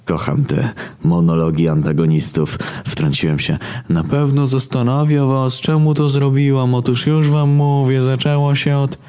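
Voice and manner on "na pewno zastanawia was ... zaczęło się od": mock villain monologue. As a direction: ham up a cartoon villain's big speech. Narrator parodies villain monologue.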